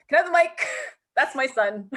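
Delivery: excited mom grabbing the mic; proud mom. Retold or imitated. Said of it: "I'm like".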